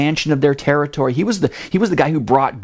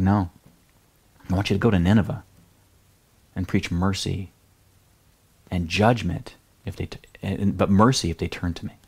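softer and lower, calm insistence; quiet, steady God; 6 semitones lower, 5 dB quieter than usual. Retold or imitated. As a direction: gently insist without raising the voice. God repeats command gently.

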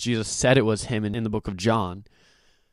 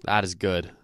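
ordinary talk, nothing marked

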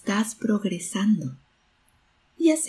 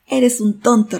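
loud, raised echo of a shout; echoed yell; 5 semitones higher, 9 dB louder than usual. A narrator's reading. Mountain echo repeats the boy's insult, loud raised shout. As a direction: repeat the yell loudly, pitched up. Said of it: "repitió la voz".

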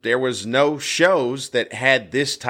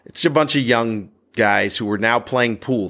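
normal talking voice

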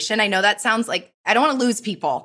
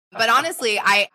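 normal talking voice

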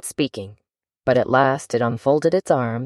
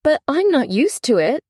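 high, pleading little voice; piteous plea; 11 semitones higher than usual. Acted. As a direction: beg pitifully. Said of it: "pleaded poor Alice in a piteous tone".

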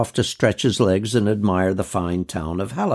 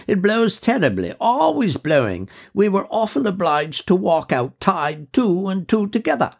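put-on much higher, genteel old lady voice; a lady of mature years, fondly remembering; high, fluting voice of an officer's widow; elderly widow; 8 semitones higher than usual. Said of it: "an officer's widow".

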